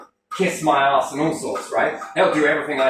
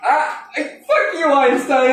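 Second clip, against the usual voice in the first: slow, high, laughing curse; laughing exasperation; 10 semitones higher, 4 dB louder, slower than usual. Real, not acted. He curses Einstein in amused frustration, laughing. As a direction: drag it out laughing in mock anger.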